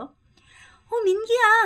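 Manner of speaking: high, loud friendly hail; high loud call. Acted. Sister calls out to Minki, high loud hail. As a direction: shout his name across the street. Role-played calling Minki.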